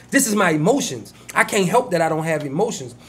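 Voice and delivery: usual speaking voice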